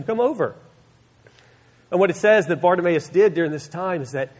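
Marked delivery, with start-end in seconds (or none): 0.0-0.6 high-pitched beckoning; urging, lifted voice; encouraging. Retold or imitated. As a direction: imitate the crowd calling him over. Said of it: "encouraged him".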